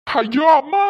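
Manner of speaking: loud anguished wail; anguished cry. Acted. Son breaks down before his mother, wailing loudly. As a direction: wail it out in despair.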